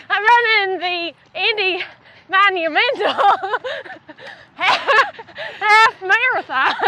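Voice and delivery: slow, drawn-out Indiana twang with laughs breaking in; twangy Indy drawl, giggling through it; Midwestern twang. Shown as a demonstration. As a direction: put on a hammed-up twang, half cracking up. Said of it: "I can do that".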